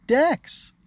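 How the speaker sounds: very high, loud squeal of a name; excited cry. Acted. Little sister to big brother, excited shout. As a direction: cry out in delighted greeting.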